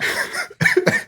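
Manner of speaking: high shrieking burst of laughter. Real, not acted. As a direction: crack up into a squealing laugh. Laughing at the comic.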